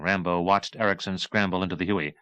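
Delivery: usual speaking voice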